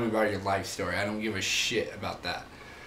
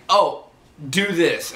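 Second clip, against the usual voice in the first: bossy coworker; 6 semitones higher, 7 dB louder, slower than usual. Retold or imitated. Coworker bossing him around.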